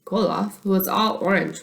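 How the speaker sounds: ordinary delivery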